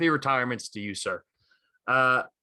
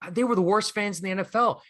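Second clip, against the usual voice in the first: much higher, heated comeback voice; 8 semitones higher than usual. Retold or imitated. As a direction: fling the challenge back at him, pitched way up. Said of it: "He's like, he's like".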